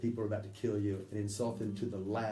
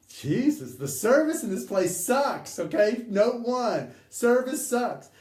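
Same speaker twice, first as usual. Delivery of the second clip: high, loud sneering insults; mock insulting; 11 semitones higher, 7 dB louder, slower than usual. Beloved mocks the bar staff, high and loud.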